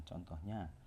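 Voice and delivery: ordinary delivery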